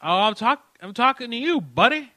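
cocky loud comeback. Acted. Comeback on the prank call.

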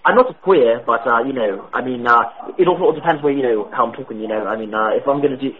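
a prank caller faking British; put-on British accent. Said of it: "sounded like someone putting on an accent".

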